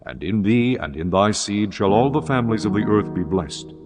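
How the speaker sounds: usual speaking voice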